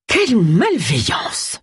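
slow indignant exclamation; drawn-out shocked exclamation; exclaiming. A narrator's reading. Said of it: "s'exclama Mrs".

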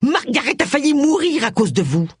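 accusation en colère, voix aiguë; reproche furieux. A narrator's reading. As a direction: jette le reproche à son visage, haut perché. Colère contre Élisabeth pendant la bagarre. (colère accusatrice, dans l'aigu)

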